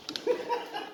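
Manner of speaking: high breathy giggle; laughing. Real, not acted. Gloating after leaving.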